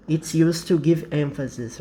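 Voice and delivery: ordinary delivery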